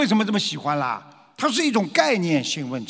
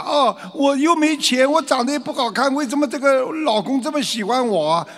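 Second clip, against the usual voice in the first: mock insecure wife; 7 semitones higher than usual. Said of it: "你不要以为啊".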